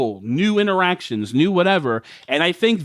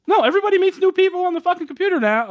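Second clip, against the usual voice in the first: excited outburst; 14 semitones higher, faster than usual. Real, not acted. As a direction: blurt it out fast and high. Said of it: "Oh, Jesus Christ, I'm old".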